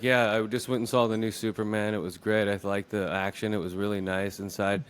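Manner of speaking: mock monotone. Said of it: "they talk in one monotone".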